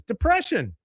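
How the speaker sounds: high, chirpy eager answer; imitated excitement. Girl answering the class advisor, imitated excitement. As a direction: pipe it up high, mock-eager. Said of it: "I just love how excited she is".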